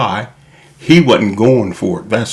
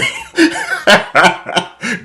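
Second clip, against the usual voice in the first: high burst of laughter. Real, not acted. Laughs at the turkey baster quote.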